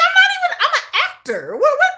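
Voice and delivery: high, laughing mock protest; bewildered actor. Fan voicing a confused actor hounded online, bewildered. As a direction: put on a baffled high-pitched voice, quick and laughing.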